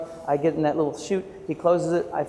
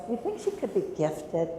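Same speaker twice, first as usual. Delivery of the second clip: lightly voiced hopeful parent; 3 semitones higher, 7 dB quieter than usual. Parents ask teacher.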